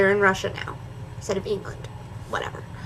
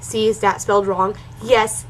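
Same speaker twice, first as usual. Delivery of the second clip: usual speaking voice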